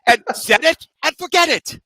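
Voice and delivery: infomercial slogan. Retold or imitated. Podcast host quoting an infomercial slogan, lightly hammed.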